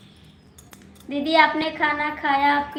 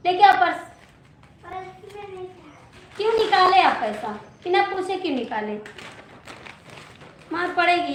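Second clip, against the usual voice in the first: scolding; 5 semitones higher, faster than usual. Real, not acted. Scolding child who took money. (ऊँची आवाज़ में डाँटते हुए)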